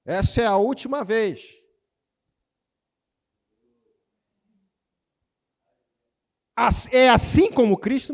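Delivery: high, loud emphatic preaching. Real, not acted. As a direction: drive it home at full force. Pregador enfatiza. (pregação em voz alta)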